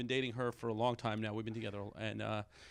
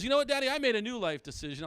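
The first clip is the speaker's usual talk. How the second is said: lightly voiced kid, pitched up and louder; son announcing; 9 semitones higher, 4 dB louder than usual. Son reports a new decision to dad, lightly voiced kid. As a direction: announce it like a child with news. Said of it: "He goes".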